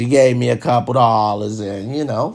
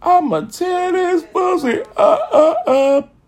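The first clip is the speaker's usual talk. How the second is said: hammed-up excitement; slower than usual. Reacting to her buying the sneakers, high excited whoop. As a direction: yelp it with mock glee. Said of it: "I said".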